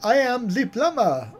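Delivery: a hint of cartoon Italian plumber; Italian accent. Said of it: "I can do Italian as well".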